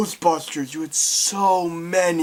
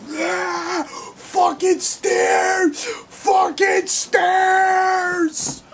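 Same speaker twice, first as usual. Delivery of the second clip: high, drawn-out wailing cry, very slow; slow anguished shriek over the stairs; 14 semitones higher, much slower than usual. Retold or imitated. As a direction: howl it out like a despairing machine. Robot panicking at stairs.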